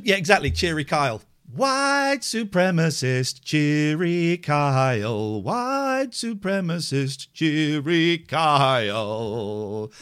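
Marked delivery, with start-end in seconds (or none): 1.5-9.9 slow teasing chant; drawn-out mock label. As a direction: stretch each word to tease the caller.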